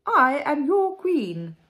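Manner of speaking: slow, high, loud royal proclamation; grand queen. A narrator's reading. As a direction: assert your rank with pomp. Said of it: "replied the Queen".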